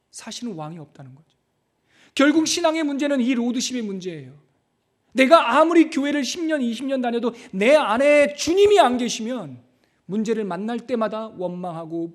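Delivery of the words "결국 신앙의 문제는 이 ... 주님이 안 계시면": raised, pressing preacher's voice; higher, insistent sermon delivery; forceful preaching. Real, not acted. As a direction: drive the point home to the congregation.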